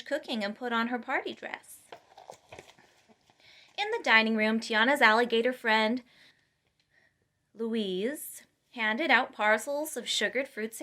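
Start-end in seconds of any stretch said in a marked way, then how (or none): none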